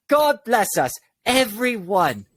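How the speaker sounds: high-pitched, gleeful exclamation; cheerful stage line, pitched well up; as happy as possible. Acted. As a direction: ring it out joyfully, stretching the last word. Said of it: "as happy as you could".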